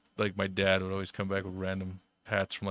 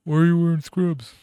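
higher, louder mimicked demand; mock nagging question; 9 semitones higher, 7 dB louder than usual. Coworkers giving him grief over scrubs, mock accusing. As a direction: imitate an accusing busybody, pitched up.

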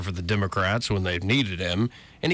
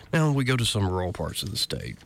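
ordinary delivery

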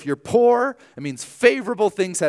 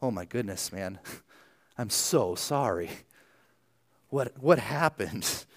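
mock condolence; 3 semitones lower, 5 dB quieter than usual. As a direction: console a friend as if tragedy had struck. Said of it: "if I said something like".

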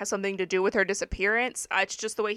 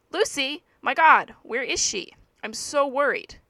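worried outburst, pitched up and louder; agitated, upset; 5 semitones higher, 6 dB louder than usual. A narrator's reading. Friend asking a stranger about missing Lucy, agitated. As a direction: blurt it out with anxious urgency. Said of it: "Louise became agitated and upset".

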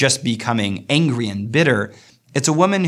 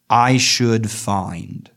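slow deliberate reading; much slower than usual. Shown as a demonstration. Teacher stretching the short line to show its weight. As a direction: draw out I should find very slowly.

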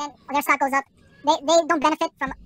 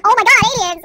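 high, loud mock gasp of wonder; mock gullible excitement; 7 semitones higher, 10 dB louder than usual. Mocking gullible people who believe alien videos, hammed-up shriek. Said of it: "videos of aliens speaking, like".

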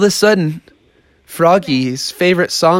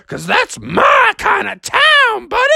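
boisterous bull; slower than usual. Acted. Benny the Bull barges in on Froggy, boisterous. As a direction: holler it high and drawn out.

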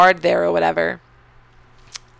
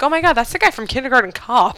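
mock surprise; 6 semitones higher, faster than usual. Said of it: "But I'm like".

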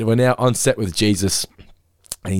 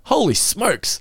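excited exclamation; 12 semitones higher, much slower than usual. Shown as a demonstration. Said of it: "I love the term holy smokes".

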